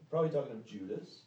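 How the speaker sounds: usual speaking voice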